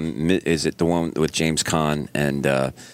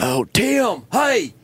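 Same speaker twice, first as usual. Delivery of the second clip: very high, excited moviegoer holler; Bobby; much slower than usual. Retold or imitated. Imitating friend Bobby reacting at the movies, excited yelling. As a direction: yell out at the screen. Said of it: "Bobby's the one they go to a movie with".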